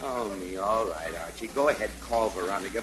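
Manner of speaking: low, soft giving in; weary resignation. Acted. Father giving in to son's phone call, wearily resigned. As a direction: sigh and surrender.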